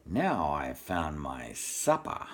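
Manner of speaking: low, slow greedy wolf murmur; wolf's low thought. Acted. Hungry wolf to himself, low and slow. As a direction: savour the words like a hungry wolf.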